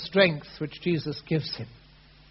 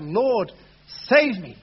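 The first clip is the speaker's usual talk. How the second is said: crying out; 5 semitones higher, 9 dB louder, slower than usual. Acted. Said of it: "he cries out".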